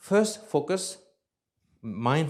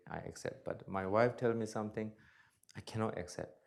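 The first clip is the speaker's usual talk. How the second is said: ordinary talk, nothing marked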